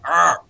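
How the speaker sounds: loud, high mock roar of rage; a brief, hammed-up angry growl as he smashes the droid; Grievous roar. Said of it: "Grievous is just like".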